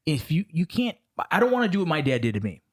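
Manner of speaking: usual speaking voice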